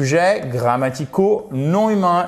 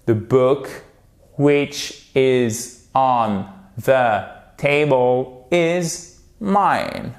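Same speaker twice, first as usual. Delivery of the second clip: very slow, word by word; repeat-after-me; much slower than usual. Shown as a demonstration. Teacher leads students repeating the sentence, very slow. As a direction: separate every word for learners to follow. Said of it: "Répétons-la ensemble".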